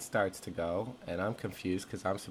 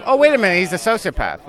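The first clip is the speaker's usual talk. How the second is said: sudden realization; 10 semitones higher, 13 dB louder, faster than usual. Pretend. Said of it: "be like".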